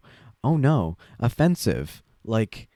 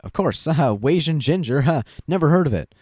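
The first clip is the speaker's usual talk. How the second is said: mocking quote; 5 semitones higher than usual. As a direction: sneer it like a dismissive troll. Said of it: "they're just like".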